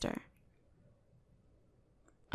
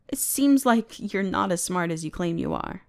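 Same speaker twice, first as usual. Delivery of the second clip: ordinary talk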